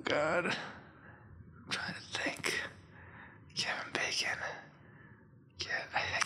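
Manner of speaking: panicked whisper to himself; anxious stammering. Real, not acted. Panicking under pressure in game.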